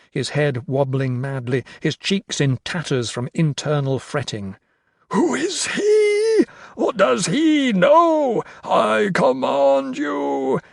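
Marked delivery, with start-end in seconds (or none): 5.1-10.6 slow, very high, wailing shout; shrieking. A narrator's reading. Carstairs questions his failing horde, shrieking. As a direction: screech in desperate disbelief. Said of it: "Carstairs shrieked".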